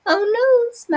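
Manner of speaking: a high squeaky oh no; little helper caught out; high surprised squeak; dismayed. Acted. Spoolie dismayed.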